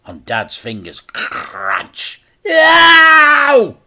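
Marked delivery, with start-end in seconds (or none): 2.5-3.8 much higher, loud cry of pain; pained scream. A narrator's reading. Dad screams as his fingers are crushed. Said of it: "Dad screamed".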